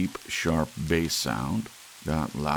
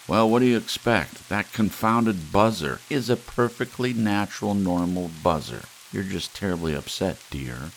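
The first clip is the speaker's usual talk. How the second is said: impatient scolding; 4 semitones higher, 6 dB louder than usual. Acted. Wife scolds jumpy husband.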